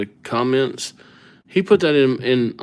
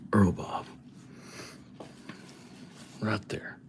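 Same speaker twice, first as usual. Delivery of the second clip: real whisper, softer; 2 semitones lower, 13 dB quieter than usual. Real, not acted. Streamer whispering the name as he writes it, hushed. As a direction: breathe the name out as you finish it. Quietly finishing the written name.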